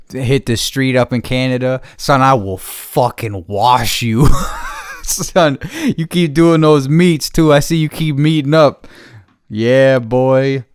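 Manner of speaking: loud heated trash talk; angry rant. Real, not acted. Ranting loud and angry at the graffiti crew. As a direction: talk down to him like a punk.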